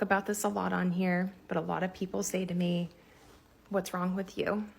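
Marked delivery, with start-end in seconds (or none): none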